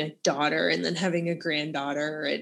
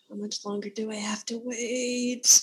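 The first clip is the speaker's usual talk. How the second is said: whispered, impatient; mock whisper; 4 semitones higher than usual. Checking Amazon orders, impatient for the book, whispered. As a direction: breathe it out like a private plea. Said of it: "I'm like".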